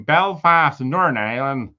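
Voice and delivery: badly faked Northern Irish accent; botched Northern Irish accent. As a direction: have a clumsy go at a Belfast accent. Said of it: "Somehow butchered that accent".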